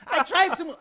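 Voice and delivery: high-pitched mock indignation; pissed-off protest. Angry stranger answers his apology, pissed. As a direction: imitate the angry man protesting. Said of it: "I know he's pissed".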